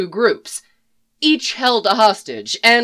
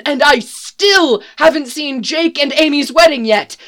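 higher and louder, pleading; exasperated plea; 5 semitones higher, 4 dB louder than usual. A narrator's reading. Hostage Rufus begging both groups to calm down, loud exasperated plea. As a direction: beg them to be civil, fed up.

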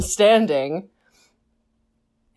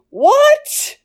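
"What?" shrieked very high and louder, stretched out; a huge, disbelieving squeal of "What?"; baffled what; 5 dB louder than usual. Retold or imitated. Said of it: "List is still going".

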